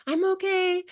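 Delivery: high, tight squeak, fighting back tears; choked-up "I'm okay". Retold or imitated. On the phone to dad, tearful high "I'm okay". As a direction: force it out while about to cry. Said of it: "I was super emotional".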